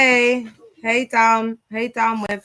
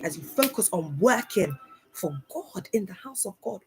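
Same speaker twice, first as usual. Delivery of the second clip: ordinary delivery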